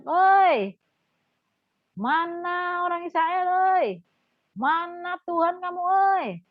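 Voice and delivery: high, drawn-out mocking giant's call; taunting giant calling out; Goliath's taunt. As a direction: jeer at the Israelites from across the valley. Goliath shouting taunts at Israel.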